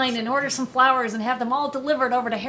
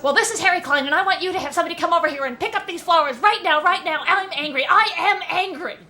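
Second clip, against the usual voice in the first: angry Harry; 8 semitones higher than usual. Retold or imitated. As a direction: play the furious caller demanding the flowers back. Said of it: "it's an angry voice on the other end".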